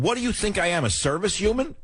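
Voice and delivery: high, indignant outburst at the dog; a retold yell at the poodle; mock angry rant. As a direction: voice up, indignant, half-joking. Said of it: "I started cursing at the walls".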